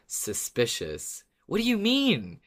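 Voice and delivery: ordinary delivery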